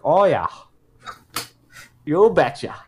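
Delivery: put-on Minnesota-accented "Oh, yeah. You betcha"; playful Minnesota accent. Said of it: "I turned Minnesota in there".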